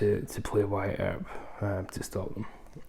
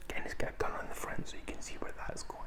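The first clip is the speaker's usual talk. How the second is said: fast conspiratorial whisper; stage whisper; 9 dB quieter, faster than usual. Real, not acted. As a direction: murmur it like a secret aside. Joking aside about the cover.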